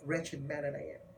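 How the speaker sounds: hushed confession; quiet self-judgement. Acted. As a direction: say it low, as a sober admission. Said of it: "He considers himself".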